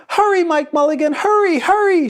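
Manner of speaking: put-on high, loud calling out of a small boy; excited kid yelling hurry; child's shout. A narrator's reading. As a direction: urge him on, shouting like a little boy. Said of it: "shouted the little boy".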